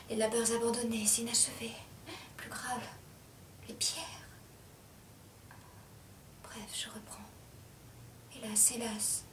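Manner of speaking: very soft, halting murmur; faint near-whisper, running down; hushed fragments. Acted. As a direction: drop each phrase into silence. Fragments after breakdown.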